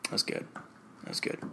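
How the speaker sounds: very soft private mutter; hushed self-praise. Acted. Performer approving his own character take, hushed self-praise. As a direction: whisper approval to yourself.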